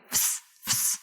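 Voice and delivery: "Fs, fs" as soft voiceless consonants only; F-S together. Shown as a demonstration. Teacher showing a difficult cluster, "Fs, fs" as bare hissed sounds. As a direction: hiss "Fs, fs" with no vowel, F running into S. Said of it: "of pronouncing F and S together".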